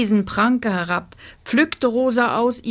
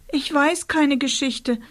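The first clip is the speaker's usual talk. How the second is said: high, pitiful little voice; plaintive; 7 semitones higher than usual. A narrator's reading. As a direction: whimper helplessly. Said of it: "sagte Rosa kläglich".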